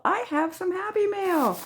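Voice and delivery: gleeful high announcement; excited announcement. Real, not acted. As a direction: announce it with a big high swoop. Announcing her mail to viewers.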